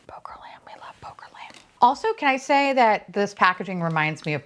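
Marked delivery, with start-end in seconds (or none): 0.1-1.5 very soft, voiceless whisper; fond whisper. Real, not acted. Collector whispering fondly to the new toy, hushed whisper. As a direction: whisper it under your breath. Murmuring the toy's name fondly.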